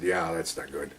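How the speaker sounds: low, soft retold reply; hushed agreement. Officer agrees with women witnesses, hushed reply. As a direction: agree under your breath.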